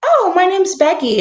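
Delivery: imitating a chummy teacher, high and loud; mock breezy teacher. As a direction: toss off the first name like to a buddy, pitched high. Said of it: "they're like".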